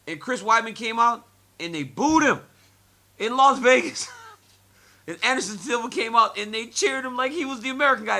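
loud, high, amazed; can't believe the crowd booed him; incredulous excitement. Real, not acted. Telling the host about Vegas booing Weidman, amazed.

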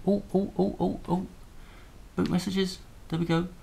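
light up as it finally works; excited. Real, not acted. The boot messages appear and he yelps with delight.

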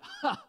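high, short mock laugh; sudden high-pitched scoff. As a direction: squeak out a dismissive ha. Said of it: "and you went".

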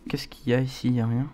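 normal talking voice

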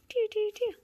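high, loud "doo doo doo"; playful toy noises. Real, not acted. Dangling a toy for kittens, high playful noises.

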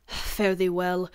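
usual speaking voice

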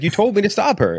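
high, mock whiny henchman protest; Russian goon. Quoting the sulky henchman from the movie, in a high mock whine. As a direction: squeak it out like a put-upon goon. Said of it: "this, like, Russian goon, like, bo-, uh, routine".

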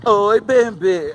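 slow, coy, drawn-out answer; flirty reply. Retold or imitated. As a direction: purr it back sweetly. (resposta manhosa e lenta, imitando a si mesmo)

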